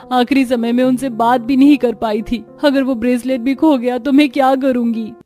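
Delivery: loud, distressed tearful pleading; crying plea. A narrator's reading. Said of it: "ढूंढती और रोने लगती है".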